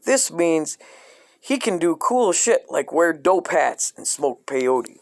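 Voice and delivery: ordinary talk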